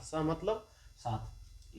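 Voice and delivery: सह मतलब साथ drawn out very slowly, word by word; slow repeat for dictation. Shown as a demonstration. Teacher to students taking notes, slow dictation of सह मतलब साथ.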